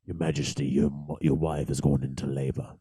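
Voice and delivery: low, hushed announcement to royalty; solemn messenger. Retold or imitated. Messenger telling the king his wife's in labor, hushed formal.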